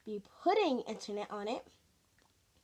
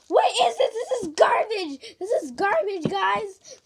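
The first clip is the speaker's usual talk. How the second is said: frustrated; 7 semitones higher, 8 dB louder than usual. Real, not acted. Frustrated with sticky slime.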